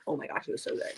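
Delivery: hushed, reverent whisper of delight; a soft whisper about how good it was; whispered rave. Real, not acted. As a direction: breathe it like a secret pleasure. Raving about the fish.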